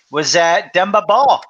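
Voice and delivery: usual speaking voice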